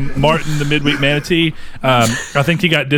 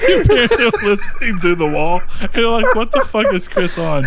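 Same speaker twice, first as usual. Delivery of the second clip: higher-pitched, amused teasing; laughing; 9 semitones higher than usual. Real, not acted. Co-host imagining parents overhearing the bit, laughing as they tease. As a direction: talk through the giggles.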